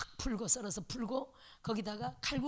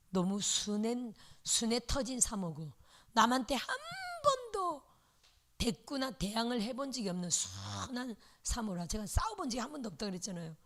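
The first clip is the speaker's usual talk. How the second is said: ordinary talk, nothing marked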